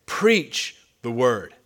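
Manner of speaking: "preach the word" drawn out slowly, each key word weighted; emphatic command. Retold or imitated. Apostle charging young pastor to preach, slow and emphatic. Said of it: "with any more emphasis".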